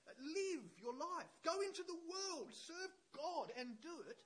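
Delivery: high, loud, urgent exhortation; full-force preaching. Real, not acted. Preacher urging congregation to live boldly, full-force exhortation. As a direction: drive each command home at full voice.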